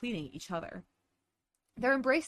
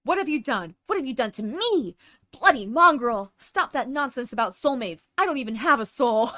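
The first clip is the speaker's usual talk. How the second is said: angry Edward; 4 semitones higher, 9 dB louder than usual. Acted. Voicing Edward's furious outburst.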